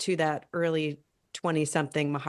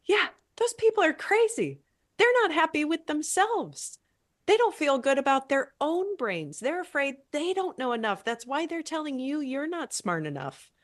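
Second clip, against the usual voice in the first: much higher, soothing and emphatic; reassuring a younger self; 7 semitones higher than usual. Pretend. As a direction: take her side against the bullies. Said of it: "And you can say".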